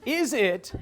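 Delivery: ordinary talk, nothing marked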